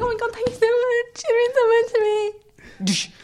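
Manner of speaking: high, mock-tearful gratitude; hammed-up, overcome with thanks; gushing thanks. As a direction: squeak it out, drawing out the end. Retelling own gushing thanks.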